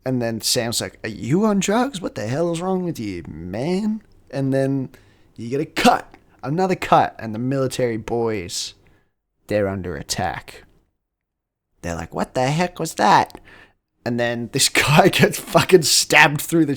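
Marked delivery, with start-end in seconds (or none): 1.0-4.0 high-pitched mock indignation; acted incredulous comeback; indignant retort. Voicing Sam's indignant retort to cop. 12.2-13.4 high, loud startled question; acted shock, pitched up; mock alarm. Voicing soldiers suddenly under attack.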